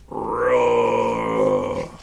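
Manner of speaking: low, drawn-out mock dragon roar. Crafter voicing his dragon's roar for the kids, low and drawn out. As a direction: growl it like a big toy dragon.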